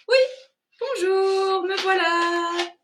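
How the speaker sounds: high chirpy little voice; cheerful thumb voice. Acted. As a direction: chirp a happy hello. Second thumb wakes and greets.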